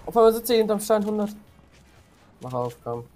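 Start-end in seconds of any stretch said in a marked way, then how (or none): none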